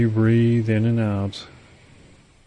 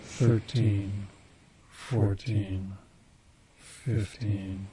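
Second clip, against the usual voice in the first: fading count; 6 dB quieter, much slower than usual. Acted. Counting down into trance.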